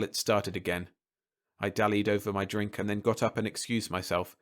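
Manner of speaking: ordinary delivery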